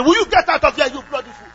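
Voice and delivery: hammed-up angry yell at an interrupting child; angry shout. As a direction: bellow it like an exasperated parent. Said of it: "Will I say".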